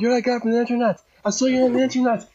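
high-pitched gushing fan retelling; jumping with excitement. Retold or imitated. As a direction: imitate yourself squealing at an idol. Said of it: "I was jumping".

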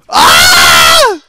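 loud panicked scream; real scream. Real, not acted. Gamer in a losing fight, screaming. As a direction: scream at full voice.

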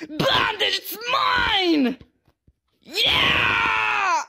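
Shrimpo. Acted. Angry shrimp claiming a bandage, hammed-up possessive yell. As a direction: yell and stretch the last words. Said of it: "play as this little angry shrimp".

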